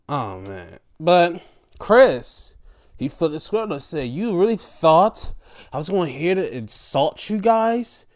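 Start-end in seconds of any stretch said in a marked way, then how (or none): 4.1-8.0 raised, emphatic mock tirade; indignant retort. Imitating Chris rebuking the guys, indignant. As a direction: act out a wounded rebuke, pitch climbing.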